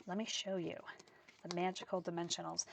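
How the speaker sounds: hushed, teasing whisper; secretive whisper. Real, not acted. Teasing reveal of the dimensionals.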